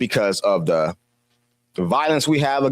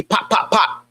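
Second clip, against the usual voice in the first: mock gunshot pops; 5 semitones higher than usual. Imitating gunshots in story.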